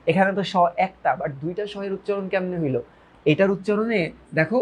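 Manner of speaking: ordinary delivery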